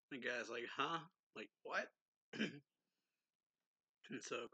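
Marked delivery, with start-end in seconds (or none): 0.8-1.9 higher, questioning rise on "what"; mock bafflement. Guy hearing his blood offered to Crowley, mock confused. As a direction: act taken aback, lightly.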